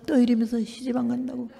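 usual speaking voice

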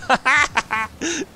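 high, loud burst of laughter; real high-pitched guffaw; laughing. Real, not acted. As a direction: crack up loudly at the reply. Amused by "No problem" reply.

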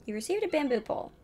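ordinary talk, nothing marked

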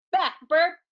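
high loud command to a burp; shouted. Acted. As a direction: shout it away, bossy and loud. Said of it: "Flower Girl shouted".

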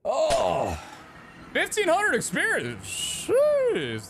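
high, squealing astonishment, drawn out slowly; amazed. Real, not acted. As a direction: exclaim in stunned delight, way up high. Amazed at a big experience reward.

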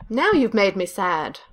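ordinary delivery

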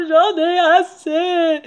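drawn-out high wail breaking into sobs; hammed-up bawling wolf; sobbing between hiccups. A narrator's reading. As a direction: sob out the words very slowly, hammed up. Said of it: "répondit le loup entre deux hoquets".

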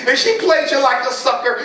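usual speaking voice

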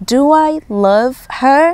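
very slow Do I love her? rising on her; slow model sentence. Shown as a demonstration.

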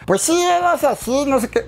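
sweet high nasal character demo; tender escorpión voice. Shown as a demonstration. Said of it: "para hacer la cosa más tierna".

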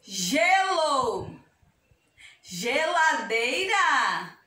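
each word stretched by syllable; slow syllable demo. Shown as a demonstration. Teacher giving children words with ge, slowly sounded out.

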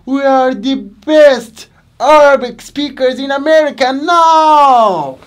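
loud, high imitation of proud students; excited boast. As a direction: play the students bragging proudly. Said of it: "they say".

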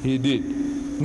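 slow, measured admission; unhurried, deliberate reply. Retold or imitated. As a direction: take your time over each word. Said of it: "the Maulana started slowly".

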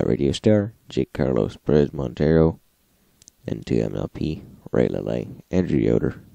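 ordinary talk, nothing marked

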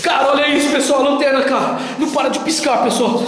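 excited. Real, not acted. Flashlight flickering, excited.

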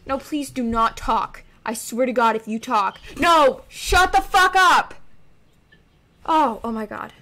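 loud furious shouting; real furious outburst; angry yell. Real, not acted. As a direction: shout them into silence. Yelling at sibling to stop talking.

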